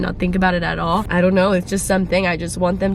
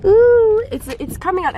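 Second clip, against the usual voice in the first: much higher, louder ooh; excited squeal; 13 semitones higher, 4 dB louder than usual. Real, not acted. Seeing the sun come out, excited squeal.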